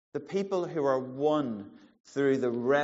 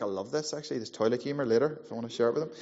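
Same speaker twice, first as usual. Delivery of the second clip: ordinary delivery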